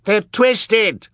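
very high, loud accusing screech; shriek. A narrator's reading. Colette condemning the children, high loud shriek. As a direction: shriek it in character. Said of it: "shrieked Colette".

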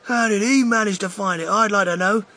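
angry shout. Acted. A large boy shouts angrily at the lucky finder. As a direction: grumble loudly at the lucky kid. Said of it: "a large boy shouted angrily".

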